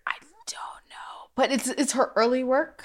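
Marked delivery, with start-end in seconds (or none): 0.1-1.3 breathy whisper, barely voiced. Real, not acted. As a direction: whisper it like a guilty secret. Shy admission about the song.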